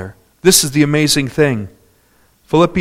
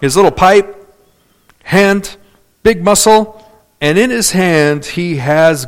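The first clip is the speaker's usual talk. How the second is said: ordinary talk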